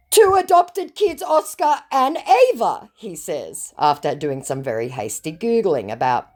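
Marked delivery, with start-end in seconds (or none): 0.1-2.9 high, slow mock scammer reply; a scammer reciting freshly googled facts, high and slow. Reading scammer's reply aloud.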